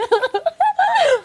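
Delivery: high, breathy giggle at herself; laughing. Acted. Dieting wife laughs at her own food dreams, giggling. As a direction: laugh off the craving.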